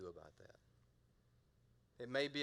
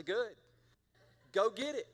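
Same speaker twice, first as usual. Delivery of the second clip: raised, louder "go get it"; retold urging; 7 semitones higher, 5 dB louder than usual. Pastor retelling how Brenda gets sent after a spider, raised urging. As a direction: playfully urge someone on from a safe distance.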